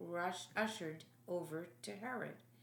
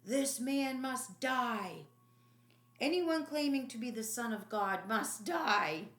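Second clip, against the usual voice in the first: angry leaders; 4 semitones higher, 5 dB louder than usual. Acted. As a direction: pound out the verdict. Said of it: "The leaders responded in kind".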